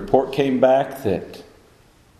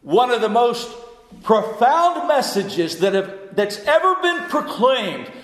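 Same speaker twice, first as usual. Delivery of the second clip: high-pitched, soaring sermon delivery; impassioned preaching; 14 semitones higher than usual. Real, not acted.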